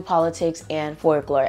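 ordinary talk

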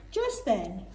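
usual speaking voice

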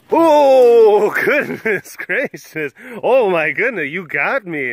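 high, loud, drawn-out play shock; mock surprise. Playing along that someone got them, mock surprise. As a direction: act hugely startled, oh my goodness. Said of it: "I'm gonna be like, "Oh, you did".

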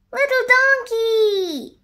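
high, slow, stretched-out call; long singled-out name, pitched high; calling shout. A narrator's reading. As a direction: holler back to a friend. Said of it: "shouted Jackie".

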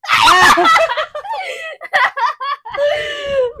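loud, high shouted laugh. Real, not acted. Laughs at fifteen pieces.

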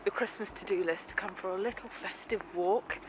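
hushed so joggers won't hear; whispering. Real, not acted. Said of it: "Why are you whispering?".

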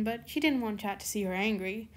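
ordinary delivery

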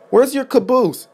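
high-pitched, questioning call; pointed question. A narrator's reading. Yard manager asking Rodney about his caboose, high and questioning.

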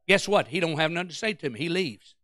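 usual speaking voice

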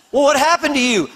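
high imitation of an upset parent; hammed-up dad pressing a crying child; questioning dad. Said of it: "My dad's going".

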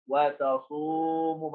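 long, sustained "oo" in وتصوم; stretched long vowel. Shown as a demonstration. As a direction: hold the waw in وتصوم as a long "oo". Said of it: "مد بالواو".

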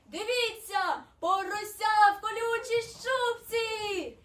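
high, loud snowflake calling friends; slow, high, ringing announcement; shouting. A narrator's reading. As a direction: stretch it out in an excited shout. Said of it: "крикнула сніжинка подруга".

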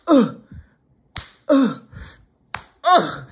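short, sharp grunts spaced apart; imitated stab grunts. Imitating what the tier heard through the door, pained grunts. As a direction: grunt as if taking each blow. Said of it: "all of a sudden you hear like".

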